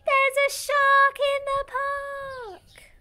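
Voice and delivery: put-on boy shouting a warning in the park; loudest shout. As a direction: yell it to the whole park. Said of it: "yells with all his might".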